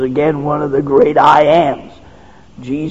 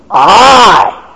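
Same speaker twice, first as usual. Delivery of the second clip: shouted, higher and louder; booming I; 5 semitones higher, 6 dB louder than usual. Acted. Preacher booms the words of Jesus at the congregation. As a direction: thunder out the single word.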